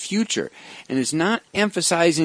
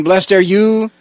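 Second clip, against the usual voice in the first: louder, rising blessing that hooks the listeners; playing to the crowd; 4 semitones higher, 5 dB louder than usual. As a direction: call it out to draw the crowd in. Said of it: "he was actually playing with the crowd".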